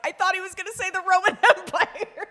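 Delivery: high, loud, laughing through the words; giggling imitation of commenters; laughing quote. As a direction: squeal it out mid-laugh. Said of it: "everybody was like".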